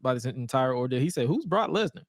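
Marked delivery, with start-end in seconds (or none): 1.3-2.0 higher-pitched imitation of an appalled bystander; lightly hammed-up disbelief; mock appalled. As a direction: act out a baffled, appalled question. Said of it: "The brother was appalled".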